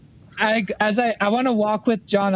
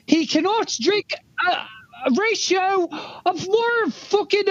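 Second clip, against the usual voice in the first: blustering Dago character; 10 semitones higher than usual. Acted. Scolding John over his diet, high blustering character voice. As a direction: stretch each word in a high-pitched rant.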